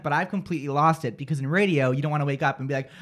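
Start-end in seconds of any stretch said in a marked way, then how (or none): none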